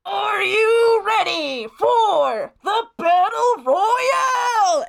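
high, drawn-out arena shout; announcer shout. Acted. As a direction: whip the crowd up like a fight MC. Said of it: "looks around and yells".

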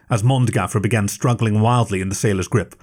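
ordinary delivery

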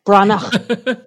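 Branagh ending in a soft breath, no hard g; correct Irish pronunciation. Shown as a demonstration. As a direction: say Branagh with the last sound like a breath. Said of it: "It's like a breath, not".

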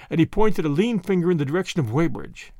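ordinary delivery

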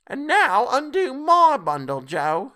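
high, loud, slow demand; bold charwoman. A narrator's reading. Charwoman telling Joe to undo her bundle, demanding. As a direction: order him in a high character voice. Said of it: "said the first woman".

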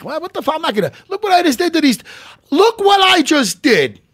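high-pitched, worked-up acted bragging; annoying voice. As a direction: crow it high and loud, playing the fighter. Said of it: "my annoying voice".

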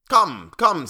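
high, loud, urging; impatient king. A narrator's reading. King prodding the slow jester, impatient. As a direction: hurry him along sharply. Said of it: "said the king impatiently".

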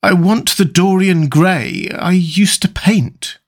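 slow, wistful longing; understated sorrow, drawn out; sad. A narrator's reading. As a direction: say it slowly, mourning a lost friend. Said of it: "said the artist sadly".

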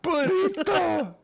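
high, loud, drawn-out Spanish accent. Shown as a demonstration. Trying a way into the accent, exaggerated Spanish accent. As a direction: ham it up hugely in a Spanish accent. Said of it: "That's a good door into that accent".

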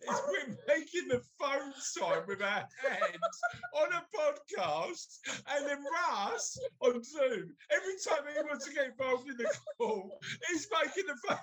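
high-pitched explaining through laughter; cracking up while trying to explain; helpless laughter. Real, not acted. Cracking up explaining phone hand sign.

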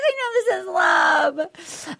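high, drawn-out wail, imitating; hammed-up lovesick wailing; mock crying freak-out. Host mimics Rachel crying and freaking out, mock wail. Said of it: "she's crying and she's freaking out".

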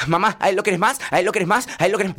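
put-on high, loud childish complaint; whiny jealous kid. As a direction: whine at mom like a jealous child. Hijos peleando por el jugo. (nene celoso quejándose, agudo y fuerte)

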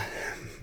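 quiet breathy laugh; soft chuckle. Real, not acted. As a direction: let a soft laugh slip out. Reader laughs after chapter.

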